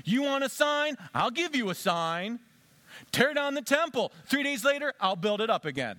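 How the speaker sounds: Jesus challenging the temple leaders, loud; defiant. Acted. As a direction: throw down the gauntlet.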